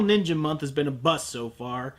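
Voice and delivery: ordinary delivery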